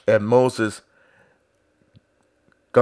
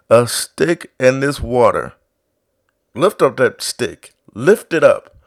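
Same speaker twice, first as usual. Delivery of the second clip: louder, rising sermon delivery, building; fiery preaching; 6 semitones higher, 5 dB louder than usual. Real, not acted. Pastor urging his congregation with fervent preaching. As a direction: preach it hard to the church.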